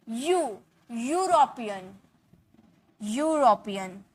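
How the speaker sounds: slow "यूरोपियन" with a long "yoo" onset; drawn-out first sound. Shown as a demonstration. Teacher letting students hear the Y, slow "यूरोपियन" with lengthened "yoo". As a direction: stretch the y glide in "यू" and "यूरोपियन". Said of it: "वाई का sound आ रहा".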